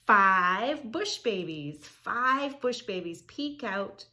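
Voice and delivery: slow, loud storybook call; dramatic announcing. Shown as a demonstration. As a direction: draw out the page title for the kids. Dramatic reading of book page.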